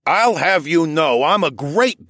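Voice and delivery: put-on slow, indignant drunk old man; lightly played proud old drunk; tipsy old elder. Drunk elder defends his dancing.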